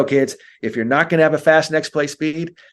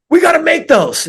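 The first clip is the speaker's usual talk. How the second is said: much higher and louder, an exasperated coach; mock coach scolding; 6 dB louder than usual. Coach griping at a kid who missed a layup, exasperated. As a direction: throw up your hands at the missed layup.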